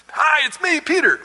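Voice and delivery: high, eager call through the door; lightly acted Peter knocking; voiced Peter at the door. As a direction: pitch it up like calling through a door.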